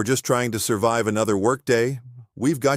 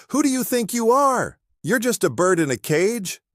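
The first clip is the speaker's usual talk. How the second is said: high, pitched-up yell; yelling at the parrot; 12 semitones higher than usual. Acted. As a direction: scold the bird sharply. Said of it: "and yells".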